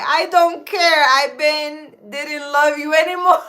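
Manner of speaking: loud, high mock comeback; defiant reply. Husband snapping back at wife, loud and defiant. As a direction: fire back like you won. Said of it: "I reply".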